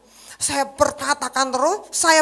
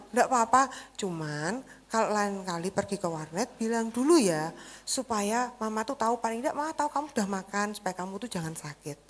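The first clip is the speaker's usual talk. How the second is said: softer, fading gentle advice; tender mother; 3 semitones lower, 8 dB quieter than usual. Retold or imitated. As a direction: speak lovingly despite anger. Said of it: "Saya tanya gitu". (ibu menasihati dengan lembut)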